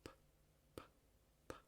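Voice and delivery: "P" solo con soplo, sin voz; p sin cuerdas vocales. Shown as a demonstration. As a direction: abre los labios rápido y expulsa aire. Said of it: "no usamos las cuerdas vocales". (p sorda, labios apretados y soplo)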